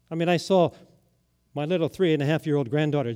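normal talking voice

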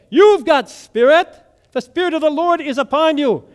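high, loud pulpit proclamation; fired-up Pentecostal pastor. Acted. Pastor proclaiming the Spirit from the pulpit, fired up. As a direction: cry it out like a revival preacher. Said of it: "he was fired up".

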